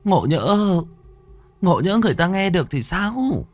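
mother aghast at her son's words; horrified mother. A narrator's reading. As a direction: scold the boy in fright for his ill-omened words. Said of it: "quan bà thất kinh".

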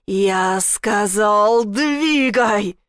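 медленное «Я сказал», затем громкое «двигай»; угрожающий рёв; заорал. A narrator's reading. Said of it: "он заорал, его голос был как вой". (воющий крик)

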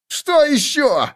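high, slow yelp; high indignant cry; shouting halfling. A narrator's reading. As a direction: yell it at the dwarf. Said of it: "крикнул полурослик".

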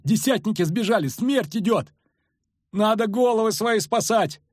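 high-pitched desperate wail; wailing moan. A narrator's reading. Soldier sees death coming, wailing in panic. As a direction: wail in panic to save yourselves. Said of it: "простонал другой".

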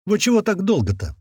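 высокий удивлённый вопрос; surprised. A narrator's reading. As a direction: ask it in real surprise. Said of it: "удивлённо спросил". (удивлённо)

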